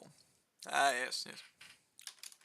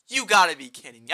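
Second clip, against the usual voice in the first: exasperated; 8 dB louder than usual. Real, not acted. Frustrated at wasted typing.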